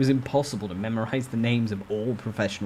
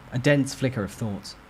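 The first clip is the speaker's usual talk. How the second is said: ordinary delivery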